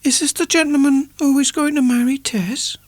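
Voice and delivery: put-on high whispered child's voice; a small child whispering to mother; breathy high whisper; child's murmur. A narrator's reading. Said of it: "the youngest murmured".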